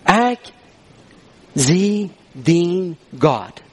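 very slow with long pauses; solemn, drawn-out verse; emphatic scripture quote. Retold or imitated. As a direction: let every word stand alone. Preacher quoting verse word by word.

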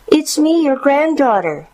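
put-on very high young girl's voice; a granddaughter announcing herself, pitched high; high, childlike answer at the door; little girl. A narrator's reading. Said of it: "she responded".

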